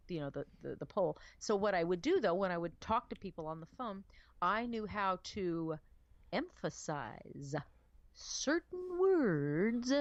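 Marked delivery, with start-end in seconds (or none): 4.9-9.9 EMPHASIZE and CERTAIN WORDS drawn out very slowly with pauses; exaggerated emphasis. Shown as a demonstration. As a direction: stretch "emphasize certain words" slowly, hamming up each word. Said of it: "being a little bit funny with my voice".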